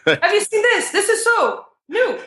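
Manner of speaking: high, singsong Ugandan English; Ugandan English accent. Shown as a demonstration. Demonstrating speech in Uganda, high Ugandan accent. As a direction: put on an emphatic Ugandan accent. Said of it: "this is how they dictate to you".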